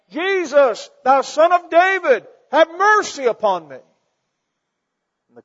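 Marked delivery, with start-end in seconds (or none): 0.1-3.8 loud, high pleading cry; desperate high yell; calling out. Acted. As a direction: beg at the top of your voice. Beggar calls out to Jesus.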